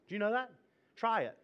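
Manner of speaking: usual speaking voice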